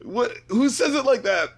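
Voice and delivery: high-pitched comic disbelief; incredulous. Real, not acted. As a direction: plead for the co-host to agree. Pleads with silent co-host.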